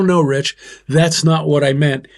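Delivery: ordinary delivery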